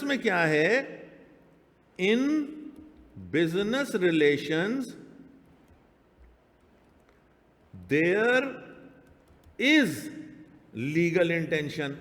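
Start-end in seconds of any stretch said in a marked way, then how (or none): 2.0-11.9 each word spaced out and stressed, slow; deliberately wrong version. Shown as a demonstration. Teacher reads the wrong business rule to class, slow and stressed. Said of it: "اب یہ جملہ بھی غلط ہے".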